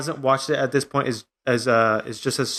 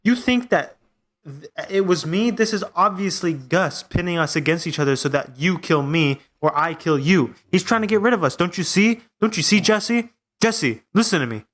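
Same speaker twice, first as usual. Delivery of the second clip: higher, slowed, urgent pleading; pleading Walt; 4 semitones higher than usual. Retold or imitated. Friend acts out Walt pleading with Jesse, higher and urgent. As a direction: beg Jesse to see the trap. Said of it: "he's saying".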